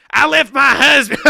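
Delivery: high, very loud mock wail; shouted caricature, far above normal pitch; mocking imitation. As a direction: belt it out as a hammed-up impression. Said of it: "let me do it again".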